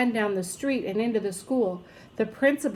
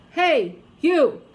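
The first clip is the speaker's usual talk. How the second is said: loud higher yell; 5 semitones higher, 6 dB louder, slower than usual. A narrator's reading. Principal yells at the crying baby, loud. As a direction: bark at the baby to hush. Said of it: "He picked up the baby and yelled".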